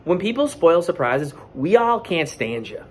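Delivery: usual speaking voice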